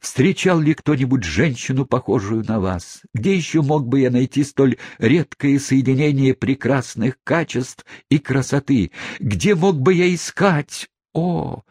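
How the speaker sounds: rising, impassioned, high; passionate. Acted. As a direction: pour out passionate praise. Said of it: "мистер Тапман умолк".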